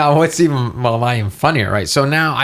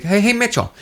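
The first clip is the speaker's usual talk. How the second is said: calling over; 9 semitones higher than usual. Retold or imitated. Neighbour calling over the fence.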